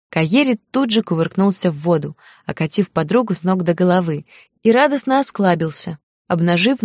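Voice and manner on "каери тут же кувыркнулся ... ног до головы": ordinary delivery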